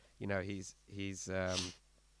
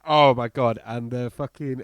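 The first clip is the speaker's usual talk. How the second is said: ordinary delivery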